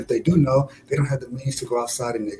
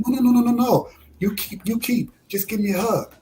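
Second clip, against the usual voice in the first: high-pitched imitation of a woman refusing; imitated female barber; 9 semitones higher than usual. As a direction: voice her insistence in a higher pitch.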